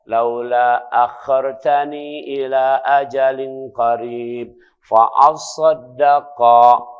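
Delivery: slow, chanted recitation of the verse; Quran recitation. Acted. Preacher reciting scripture to the congregation, slow chant. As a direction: draw out the Arabic verse slowly.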